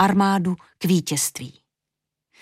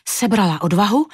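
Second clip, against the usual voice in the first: usual speaking voice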